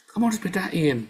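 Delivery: normal talking voice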